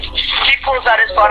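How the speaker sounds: ordinary delivery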